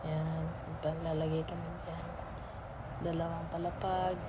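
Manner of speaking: usual speaking voice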